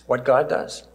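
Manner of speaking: ordinary delivery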